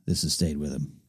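normal talking voice

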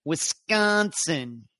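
slow upper Midwest Wisconsin; upper Midwest accent. Shown as a demonstration. Host proving he can do it, upper Midwest accent. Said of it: "I can do that in upper Midwest accent".

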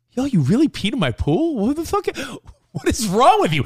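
mock disbelief. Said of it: "It's more of a laughing".

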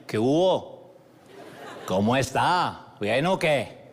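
drawn-out, sing-song Caleño greeting; Caleño accent. Retold or imitated. Hotel clerk greeting a tired guest, Caleño accent. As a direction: imitate a young Cali hotel clerk's accent. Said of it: "me dice con su acento caleño".

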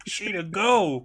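high, strained send-off; mock urgency. Host as dragged uncle warning Sheena, mock urgency. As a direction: imitate a desperate uncle urging her to flee.

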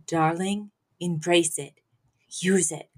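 low, soft, near-whispered mock gravity; solemn hush. Mother passing guilt power to her daughter, solemn hush. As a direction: hand it down softly, pausing between phrases.

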